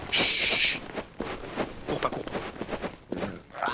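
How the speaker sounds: urgent hushed whisper. Acted. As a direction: hiss it under your breath. Sneaking up on suspects.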